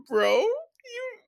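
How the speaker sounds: high, laughing disbelief; laughing mock rant. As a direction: crack up while scolding. Mocking crypto bros, laughing.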